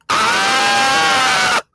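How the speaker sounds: short mock yell of pain; imitated scream. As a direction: throw out his cry as a brief yell. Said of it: "He said".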